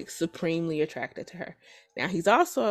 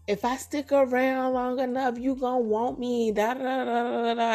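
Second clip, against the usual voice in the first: higher mocking voice of lovestruck women; imitated wishful chant, pitched up; mock hopeful women; 5 semitones higher than usual. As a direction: lightly mimic women kidding themselves.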